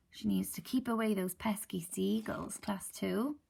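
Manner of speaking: plain reading voice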